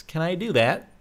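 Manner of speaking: ordinary talk, nothing marked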